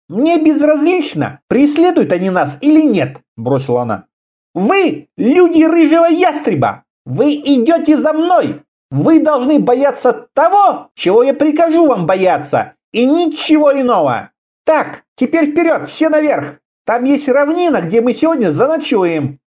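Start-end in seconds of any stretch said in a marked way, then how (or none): 0.1-3.2 high, curt female voice; dismissive woman leader. A narrator's reading. Woman leader dismisses his worry. 4.5-19.4 commanding woman leader. A narrator's reading. Woman leader commands her band.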